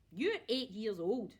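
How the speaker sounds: grandma scoffing at George; scornful snort. A narrator's reading. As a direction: sneer it with contempt. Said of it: "she snorted".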